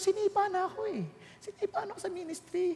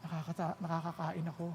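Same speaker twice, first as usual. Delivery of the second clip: grateful prayer; 9 semitones lower, 4 dB quieter than usual. Retold or imitated.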